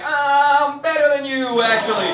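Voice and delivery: loud, slow, high smug retort; smug superiority. Retold or imitated. Answering the Zoom group, a loud smug slow brag. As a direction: lord it over them, hammed-up. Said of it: "I was like".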